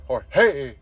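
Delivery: loud mock holler of a name; hammed-up name-calling yell, second syllable drawn out; imitated yell. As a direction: call out like a coworker across a worksite. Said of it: "when he yells to Jorge".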